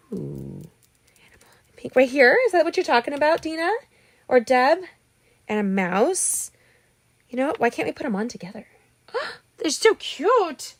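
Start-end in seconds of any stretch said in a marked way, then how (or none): 0.1-2.0 slow, breathy whisper to herself; hushed, soft whisper. Real, not acted. Repeats the comment to herself. 9.1-10.7 much higher, slow, squealing delight; gasping high squeal of delight. Real, not acted. Gasps at the cute critters.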